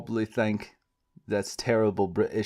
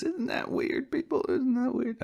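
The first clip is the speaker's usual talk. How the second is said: much higher, squeaky mock disbelief; mock squeak; 4 dB quieter than usual. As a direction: squeak it at the audience. Mock-incredulous aside to viewers.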